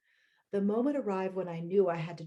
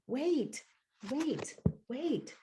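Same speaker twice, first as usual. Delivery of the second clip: panicked whisper; 7 semitones higher, much slower than usual. Retold or imitated. Panic as she loses herself.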